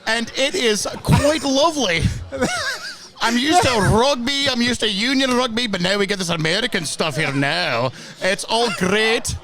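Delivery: hammed-up Scottish accent with rolled R's. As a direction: play a proud Glasgow fan in a put-on Scottish accent. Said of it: "the trill of the R's is a very nice touch".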